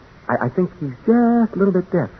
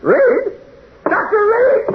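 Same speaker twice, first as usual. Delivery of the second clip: much higher, crying-out call for Reed; terrified call; slower than usual. Acted. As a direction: call for him in rising panic. Calling out in terror.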